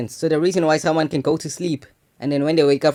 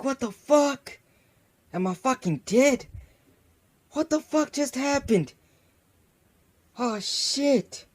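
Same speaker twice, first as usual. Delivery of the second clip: much higher, slow panicked squeal; boy seeing himself lying on the bed, high terrified squeal; panicked disbelief; 11 semitones higher, 4 dB quieter, slower than usual. Retold or imitated. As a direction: lose it in terror. Seeing his own body on bed.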